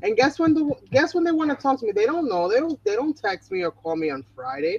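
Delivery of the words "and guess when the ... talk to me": ordinary talk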